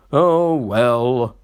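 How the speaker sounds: sulky, reluctant sigh. A narrator's reading. Engine sulkily giving in to the fireman's orders. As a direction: give in with a grudging sulk. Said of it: "said Sir Handel sulkily".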